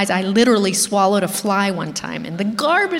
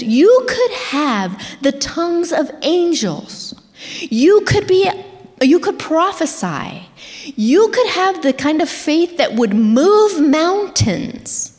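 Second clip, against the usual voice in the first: rising preaching; 6 semitones higher than usual. Acted. Preacher builds a scripture crescendo.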